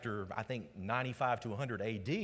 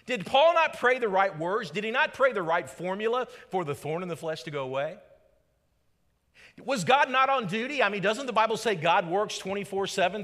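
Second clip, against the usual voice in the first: loud, higher rhetorical questions; fiery preaching; 5 semitones higher, 5 dB louder than usual. Real, not acted. Pastor presses the congregation with pointed questions, loud and high. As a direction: preach them up, challenge the church.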